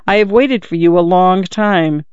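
ordinary delivery

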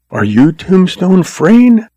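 put-on high, slow girlish question; hopeful girl seeking confirmation; hopeful young woman. A narrator's reading. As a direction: ask slowly in a high, hopeful voice. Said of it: "seeking confirmation of some hope".

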